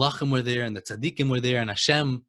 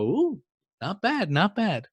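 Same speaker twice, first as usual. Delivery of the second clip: mildly impressed angels; 7 semitones higher, much slower than usual. Acted. Angels judging his Torah.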